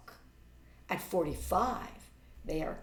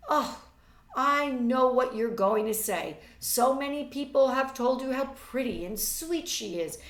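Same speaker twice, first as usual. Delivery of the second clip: high, loud and scornful; exasperated young woman; 6 semitones higher, 9 dB louder than usual. A narrator's reading. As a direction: complain with impatient disdain. Reads Marjorie's complaint.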